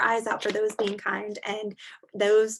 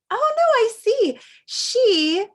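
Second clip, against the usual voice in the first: high, loud, delighted; a teacher thrilled by the page; excited gasp; 12 semitones higher, 8 dB louder, slower than usual. Real, not acted. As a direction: burst out with excitement at the picture. Excited reaction to picture.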